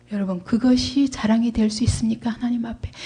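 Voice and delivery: ordinary talk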